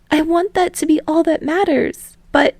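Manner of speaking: put-on a boy confessing love while holding back; emotional confession. Daiki's line continues.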